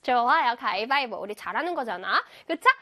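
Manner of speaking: ordinary delivery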